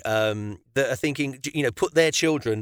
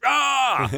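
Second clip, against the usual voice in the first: high mock frustrated yell; half-hearted angry shout; angry outburst; 10 semitones higher than usual. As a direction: burst out like Mister Angry Man. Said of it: "Mister Angry Man".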